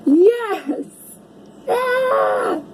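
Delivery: high excited yes, then a squeal; joyful shout. Real, not acted. Reader thrilled the card fits her day, high joyful cry. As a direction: cheer for the card.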